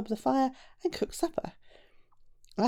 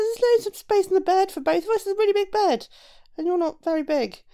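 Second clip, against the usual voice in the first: high, louder giant character voice; coaxing giant; 10 semitones higher, 4 dB louder than usual. A narrator's reading. Giant telling the shoemaker there's room in bed, high and coaxing. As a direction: talk him into it, pitched high.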